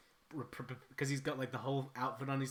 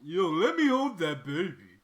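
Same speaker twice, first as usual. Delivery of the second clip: mock Rocky shout; 4 semitones higher, 12 dB louder than usual. Rocky character voice.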